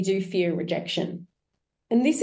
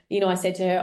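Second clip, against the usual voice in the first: ordinary talk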